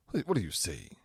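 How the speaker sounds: ordinary talk, nothing marked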